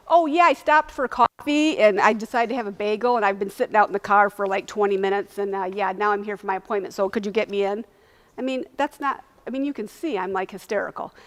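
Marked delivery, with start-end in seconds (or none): 0.1-7.8 casual rambling excuse, loud at the start; mock breezy. Imagined carefree patient asking receptionist to squeeze her in, mock breezy. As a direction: pretend you haven't a care about being late. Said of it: "it wasn't like I was like".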